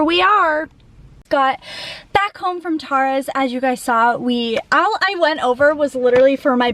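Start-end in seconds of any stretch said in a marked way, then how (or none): none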